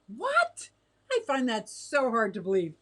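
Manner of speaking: shocked disbelief. Real, not acted. Reacts to Candy being called mean.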